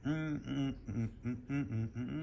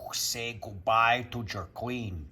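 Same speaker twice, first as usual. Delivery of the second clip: gloating; 2 semitones lower, much slower than usual. Real, not acted. Gloating over chess move.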